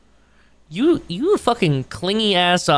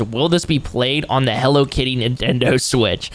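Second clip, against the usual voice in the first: usual speaking voice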